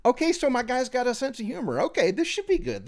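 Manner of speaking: high, eager mock optimism; imitating her own hopeful reaction, pitched high. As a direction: sound briefly thrilled about the guy. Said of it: "And I'm like".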